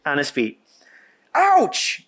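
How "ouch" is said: sudden high yelp of pain; scream. A narrator's reading. Wilbur yelping at the rat's bite, sharp high scream. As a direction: yelp as the tail is bitten. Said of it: "he screamed".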